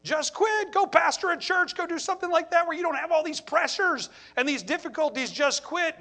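tempting voice. Retold or imitated. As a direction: coax him to give up. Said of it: "Satan has whispered in my ear".

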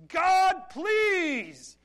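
high, very loud drawn-out cry to God; desperate scream in prayer, re-enacted; screamed plea. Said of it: "I screamed out on my knees".

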